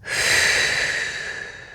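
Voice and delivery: long voiceless sighing exhale; letting-go exhale. Shown as a demonstration. Guide exhaling along with the listener, releasing sigh. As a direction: blow the breath out slowly to let go. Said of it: "as you exhale, let go".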